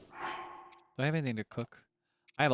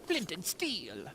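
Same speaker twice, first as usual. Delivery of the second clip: item fanfare voice; 6 semitones higher, 4 dB quieter, much slower than usual. Pretend. Item pickup announcement in game.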